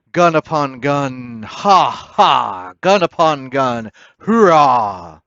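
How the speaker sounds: loud, exultant battle cry; cheering the cannon fire; rousing cheer. Acted. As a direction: roar it over the guns.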